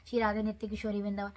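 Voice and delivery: fast, flat rattle-off of the names; rushed rote reading. Shown as a demonstration. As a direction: gabble it like rote memorisation. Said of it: "ये रट्टा नहीं मारना है". (तेज़ी से रट्टा मारते हुए पढ़ना)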